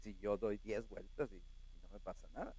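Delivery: lightly voiced church member brushing it off; soft mocking churchgoer voice; mock skeptical church member. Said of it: "usted me dice".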